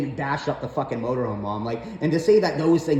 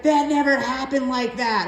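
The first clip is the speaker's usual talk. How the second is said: high, whiny mom denial; mocking mom voice; 9 semitones higher than usual. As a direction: play mom brushing it off, pitched up. Son mimics mom's denial.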